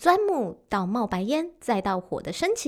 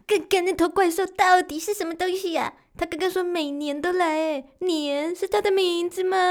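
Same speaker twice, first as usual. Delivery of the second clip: put-on higher, wondering child-like voice; puzzled little creature asking; 高音好奇的小原始人; curious little caveman; 7 semitones higher than usual. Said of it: "叽里叽里第一个说话".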